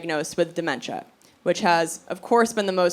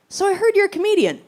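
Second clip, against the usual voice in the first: doctor; 11 semitones higher, faster than usual. Retold or imitated. Doctor voiced in retold scene.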